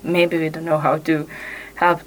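ordinary talk

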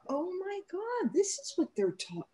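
high, soft mock-overwhelmed gasp of awe; retold tearful awe. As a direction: re-enact being moved to tears, high and hushed. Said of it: "I stood at my podium and sobbed".

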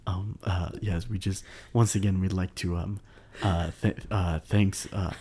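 stumbling through with lots of uhs; real fumbling, stop-start delivery; halting hesitation. Real, not acted. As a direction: stall softly between words. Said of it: "you keep saying this like this".

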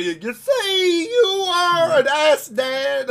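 high, slow, hammed-up Bill Cosby voice with a cry in it; Bill Cosby impression. Son mocking a beating dad in a Bill Cosby voice. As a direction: wail it out like a bad Bill Cosby. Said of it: "start talking like Bill Cosby".

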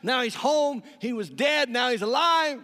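loud, high preacher's climax; preaching at full force. Acted. Preacher crying out the homecoming, loud and high. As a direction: proclaim it to the whole church.

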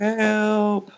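high hammy yelp for help; mock cry for help. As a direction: squeal for help like a victim.

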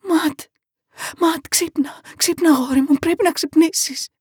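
high, frantic mother's cry; desperately trying to wake her son; urgent, panicked pleading pitched high; screaming her son's name. A narrator's reading. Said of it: "άρχισε να ουρλιάζει το όνομα του γιού της".

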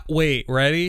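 normal talking voice

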